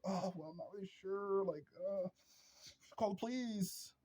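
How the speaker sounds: high, soft dithering voice; mock timid man. Mocking a feminine man in danger, high soft dithering.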